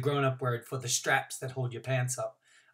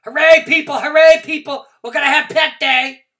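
very high, very loud cheering; excited shout; 13 semitones higher, 17 dB louder than usual. A narrator's reading. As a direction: yell it out with total delight. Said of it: "I shouted".

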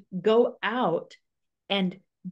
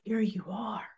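soft, breathy whisper to a newborn; tender whisper; 7 dB quieter than usual. Retold or imitated. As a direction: whisper it lovingly, like a prayer answered. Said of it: "she probably spoke to him and said".

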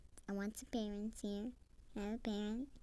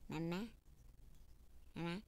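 put-on baby voice; 3 semitones lower, 5 dB quieter than usual. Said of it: "I'm gonna say mama".

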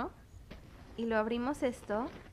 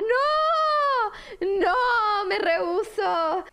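mock crying; 6 semitones higher, 5 dB louder, much slower than usual. Real, not acted. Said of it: "Voy a llorar".

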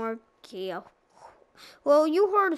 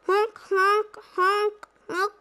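high honking like a clown horn; clown horn honks; 7 semitones higher than usual. Retold or imitated. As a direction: honk like a silly clown. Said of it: "that's my Banana Split voice".

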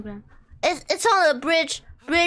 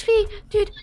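ordinary talk

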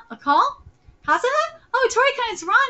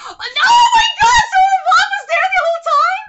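gasp, then a much higher loud yell; shocked shout; 9 semitones higher, 6 dB louder, faster than usual. Real, not acted. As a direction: blurt out the shock of the twist. Shocked by the mother reveal.

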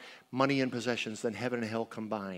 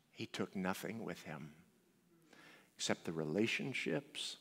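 quiet reflection; 7 semitones lower, 9 dB quieter than usual. Real, not acted. Sobering memory of dad.

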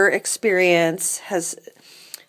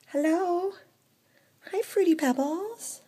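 much higher, slow sweet hello; pet-talk greeting; 9 semitones higher, 3 dB quieter, slower than usual. Acted. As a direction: greet the kitty in a cooing voice. Greeting the cat in high voice.

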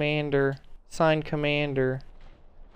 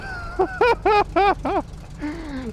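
high-pitched wheezy laughing fit; high helpless laugh. Real, not acted. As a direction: laugh until you nearly cry. Cracking up.

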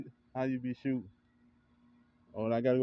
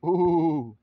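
loud high hyped ooh; excited crowd-style ooh; 6 semitones higher, 9 dB louder than usual. Real, not acted. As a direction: cheer the shot with a big ooh. Reacting to a shot.

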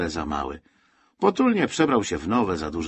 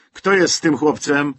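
loud, higher, impatient demand; calling out; 5 semitones higher, 6 dB louder than usual. A narrator's reading. Soldier fending off a boy asks who is with him, calling out. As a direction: raise your voice over a crowd. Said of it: "krzyknął żołnierz".

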